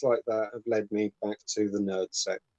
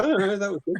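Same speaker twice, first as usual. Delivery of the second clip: ordinary delivery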